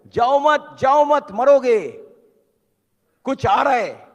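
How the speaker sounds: high, loud acted shout to people far ahead; shouted warning. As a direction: yell the warning from behind, drawing it out. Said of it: "वो पीछे से बोल रहा है". (चिल्लाकर दी गई चेतावनी)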